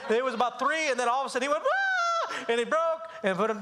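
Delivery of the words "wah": very high mock wail; imitated breaking cry. Dad imitates his son breaking during spanking, high wail. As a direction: burst into a high, comic kid's bawl. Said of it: "when the cry".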